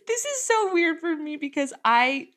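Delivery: nervous. Real, not acted. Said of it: "he's making me so nervous".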